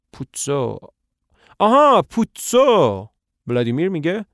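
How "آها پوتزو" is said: high, loud, slow aha of realization; sudden recognition. Acted. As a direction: stretch out آها as if it just clicked. Estragon suddenly recognizes name.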